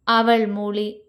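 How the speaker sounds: ordinary delivery, nothing marked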